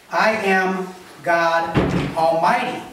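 slow, spaced-out "I am God Almighty"; emphatic. Shown as a demonstration. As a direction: weigh each word of "I am God Almighty". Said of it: "I'm emphasizing this".

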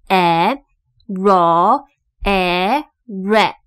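slow, separated E, r, E, re; sounding out short e. Shown as a demonstration. Teacher showing the first syllable, sounding out re. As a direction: blend r and short e into re as in recipe. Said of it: "第一个音节的E是念成E".

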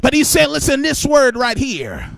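loud, ringing sermon cadence; preaching at full force. Acted. Preacher calling out to the congregation in full force. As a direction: preach it with rising fire.

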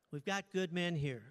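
ordinary talk

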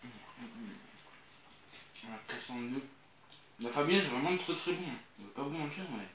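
talking while chewing food; biting into chicken wing; mouth full. Real, not acted. Seen 2: Holds chicken, bites and chews at mouth while speaking.

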